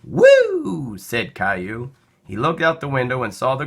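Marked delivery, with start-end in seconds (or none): 0.0-1.0 put-on high, loud child's whoop of delight; high-pitched cheer; excited child whoop. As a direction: let out a thrilled kid's woo as the plane lifts. Child thrilled at takeoff.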